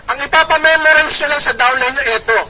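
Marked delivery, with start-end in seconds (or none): none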